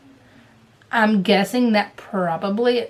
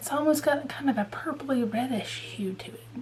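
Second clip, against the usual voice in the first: hushed admiring whisper; 2 semitones higher, 9 dB quieter than usual. Real, not acted. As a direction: lean in and whisper it like a secret. Peering closely at the thread.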